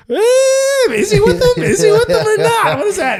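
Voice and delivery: very high, squealy onlooker voice; mock puzzled crowd. Boston bar crowd eyeing him, mock bewilderment. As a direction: imitate baffled bystanders in a high strained voice.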